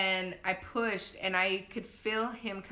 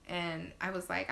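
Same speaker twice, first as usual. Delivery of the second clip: ordinary talk, nothing marked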